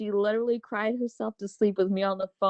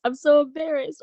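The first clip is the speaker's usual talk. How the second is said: higher, louder, mock tearful whimper; imitating drunk crying daughter; 7 semitones higher, 5 dB louder than usual. Mom imitating her drunk daughter's late-night call, mock tearful. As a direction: play the upset daughter, lightly teary. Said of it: "And she was crying".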